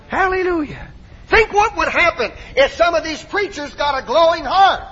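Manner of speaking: high, ringing pulpit cry; exuberant preaching. Real, not acted. Preacher imagining preachers with glowing hearts, exuberant preaching. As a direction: call it out to rouse the church. Rallies the congregation.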